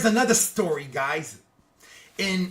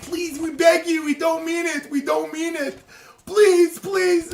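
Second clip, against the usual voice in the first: begging; 8 semitones higher than usual. Retold or imitated. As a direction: plead as if all is lost. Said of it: "ten minutes after they begged".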